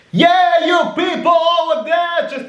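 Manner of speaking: high, booming stadium announcer. Shown as a demonstration. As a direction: call out to the back rows of a stadium. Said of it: "You hear that?".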